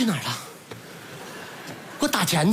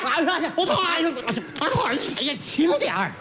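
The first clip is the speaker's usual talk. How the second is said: higher-pitched yelps of pain; crying out in pain; 4 semitones higher than usual. Acted. Hurt patient begging for a gentler touch, pained yelping. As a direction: wail and yelp as it hurts.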